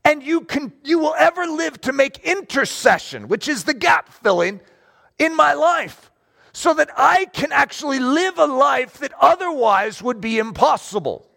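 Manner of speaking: high, impassioned declaration to God; emphatic praise; fervent prayer. Acted. Acted prayer builds in fervor.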